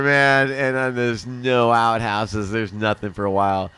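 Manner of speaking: ordinary talk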